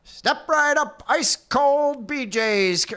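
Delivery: high, slow fairground call; old-timey carnival barker. Pretend. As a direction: cry it out like a sideshow barker. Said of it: "Old-timey Carnival Barker".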